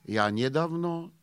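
slow, weighty recital of the line; measured Russian-flavoured poem reading. Acted. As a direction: declaim it slowly like a poet. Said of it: "básní".

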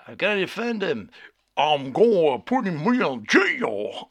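loud, hammed-up Southern American caricature; mock a bad Southern American accent, stretching words; Benedict Cumberbatch's Southern accent. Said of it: "he says, Benedict".

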